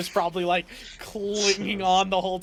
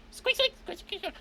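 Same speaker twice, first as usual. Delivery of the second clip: tiny high rat squeaks; rubbery high squeaky toy; 4 dB quieter than usual. Acted. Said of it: "looks at you and goes".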